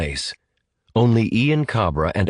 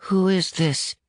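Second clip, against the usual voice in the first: put-on much higher, small boy's voice; young caller; 12 semitones higher than usual. Unknown boy phones the command center, high and wary. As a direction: pitch it high like a wary child.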